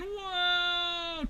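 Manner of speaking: high, drawn-out whoa; acted alarm. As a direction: cry out as if in a steep dive. Bear cries as bird dives.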